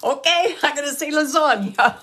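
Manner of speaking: loud playful okay, fine; exasperated giving in. Retold or imitated. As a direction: give in with a laugh in the voice. Her own amused reply retold.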